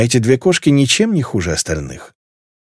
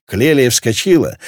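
plain reading voice

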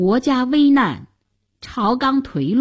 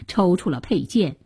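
plain reading voice

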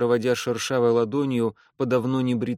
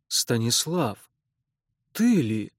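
stunned; slower than usual. Acted. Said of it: "выдавил опешивший казак".

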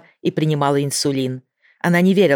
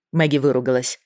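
ordinary delivery, nothing marked

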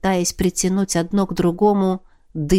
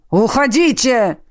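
pleading shout; 4 semitones higher, 3 dB louder than usual. A narrator's reading.

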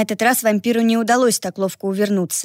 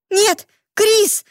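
high breathless cry of fear; gasped out; 12 semitones higher than usual. Acted. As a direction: gasp it out, horrified. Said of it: "выдохнула Ривер".

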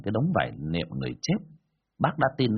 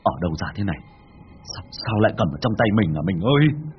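stammering shock; 4 semitones lower, 3 dB louder than usual. Acted. Husband stammers seeing gold.

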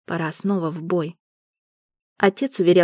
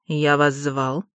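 ordinary delivery

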